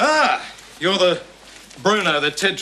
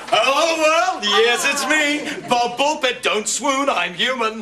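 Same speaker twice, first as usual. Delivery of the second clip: high, grand hello; self-admiring showman; 7 semitones higher, slower than usual. Acted. Uncle greeting the family as a heart-throb, high and showy. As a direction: present yourself as the star.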